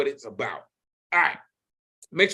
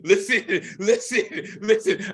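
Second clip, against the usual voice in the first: laughing; 5 semitones higher than usual. Real, not acted. Host laughing after cutting guest off.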